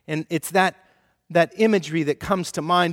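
ordinary delivery

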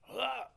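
short, high mock scream; hammed-up scared "ah"; startled yelp. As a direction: yelp as if jumping at a fright. Acts out reaction to gunshot.

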